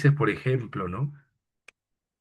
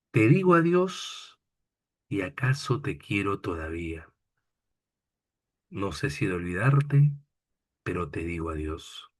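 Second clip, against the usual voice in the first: poem recitation. Acted. Teacher recites a farewell poem.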